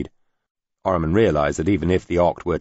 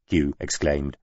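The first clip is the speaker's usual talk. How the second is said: plain reading voice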